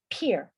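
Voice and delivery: "pear" mispronounced to sound like "pier"; wrong vowel. Shown as a demonstration. Teacher warning students how not to pronounce it, "pear" wrongly said as "pier". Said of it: "no se pronuncia".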